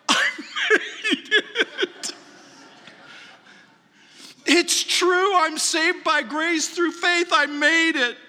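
high, slow, laughing cry of relief; overjoyed relief. Pretend. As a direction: savor arriving in heaven, laughing with joy. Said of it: "you're gonna go".

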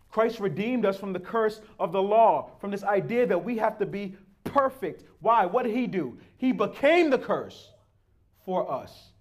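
preaching at full force. Acted.

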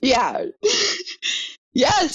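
high, breathless laugh through the words. Real, not acted. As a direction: laugh while confirming it. Laughing at ex's insult.

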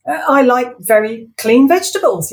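high, loud nervous babble; nervous excuse; flustered Ivor. Acted. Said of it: "he found himself saying".

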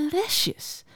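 soft, high musing; peinzend. A narrator's reading. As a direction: say it as if turning the word over. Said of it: "zei hij peinzend". (zacht en peinzend)

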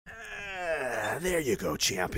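pat the rookie on the head; patronizing radio personality. Acted. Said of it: "some kind of radio personality should have just came in here and it'd been like".

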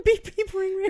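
high laughing beep, beep, ring, ring; laughing phone imitation. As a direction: play along with the ringing phone through a laugh.

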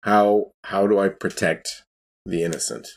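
ordinary delivery

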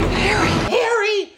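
high, loud mock shriek; mock scream of Harry. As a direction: scream her husband's name in alarm. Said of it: "she's like".

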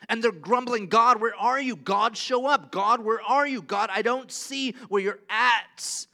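loud, high whiny complaint to God; mock-complaining Israelites, loud and pleading; grumbling people.

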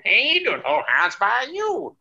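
high hillbilly voice with a Southern twang, slow on the second line; answering the phone in a goofy Southern accent; hillbilly greeting. Shown as a demonstration. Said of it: "put on the hillbilly voice".

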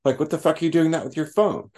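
high, sharp telling-off; scolding rant. Retold or imitated. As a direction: scold them with contempt. Telling people off in meeting.

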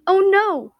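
high, loud squealed exclamation; a startled passenger's squeal. A narrator's reading. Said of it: "a person squealed".

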